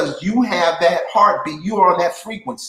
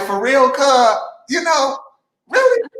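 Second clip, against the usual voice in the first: incredulous; 8 semitones higher, 4 dB louder than usual. Retold or imitated. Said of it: "I'm like".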